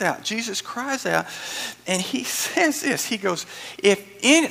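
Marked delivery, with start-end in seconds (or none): 3.8-4.5 cry out. Acted. Jesus crying out at feast.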